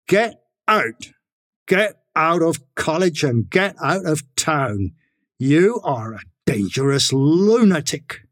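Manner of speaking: a mildly acted furious registrar; furious order. A narrator's reading. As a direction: throw him out of the office. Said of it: "He was furious".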